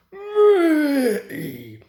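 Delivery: high, loud offended cry; indignant outburst. A narrator's reading. Gordon fuming about his record, indignant. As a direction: huff out the word like steam. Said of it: "letting off steam".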